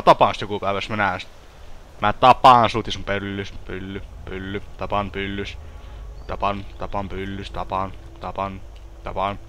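low, very soft repetitive muttering; muttered mock threat. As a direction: chant it under your breath, low and quiet. Mumbles mock threat to self. (hiljaista, matalaa uhkausmutinaa)

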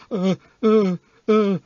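put-on high, halting little-boy uh uh uh; tongue-tied stammer. A narrator's reading. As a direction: freeze up in front of Santa. Tongue-tied kid before Santa.

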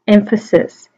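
ordinary talk, nothing marked

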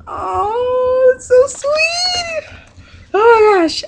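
very high, slow, drawn-out coo; touched, gushing squeal. Real, not acted. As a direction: melt over a friend's sweet gift. Moved by gift and note.

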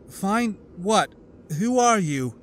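put-on confused girl. A narrator's reading. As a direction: ask in honest bewilderment.

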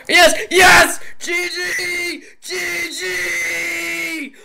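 high, crying-out victory yell; ecstatic victory shout. Real, not acted. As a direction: scream with relief and joy. Winning the fight.

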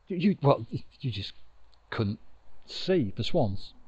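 plain reading voice

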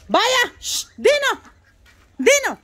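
high, loud calls of the dog's name; shooing the dog. Real, not acted. Owner shooing her dog Dino off the chickens, loud high call. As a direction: shout the dog away from the chickens.

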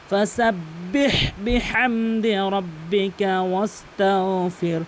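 slow chanted ফাসাব্বিহ বিহামদি, strong throaty h; heavy throat h. Shown as a demonstration. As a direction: intone it slowly, h from the throat. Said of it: "এখানে কিন্তু বড় হা".

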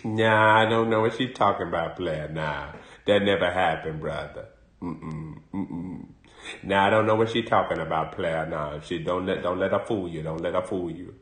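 low mumbling denial as Willie; Willie denying. Retold or imitated. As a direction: play the guilty man who brushes it off low. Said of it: "Willie L".

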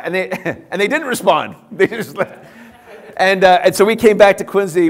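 laughing loudly through the words. Real, not acted. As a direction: let real laughter spill over the telling.

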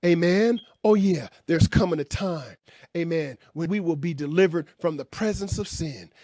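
normal talking voice

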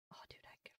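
soft unvoiced whisper. Real, not acted. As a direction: breathe it under your breath. Whispers while reading menu.

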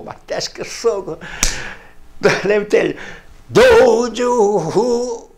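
usual speaking voice

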